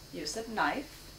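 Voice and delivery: usual speaking voice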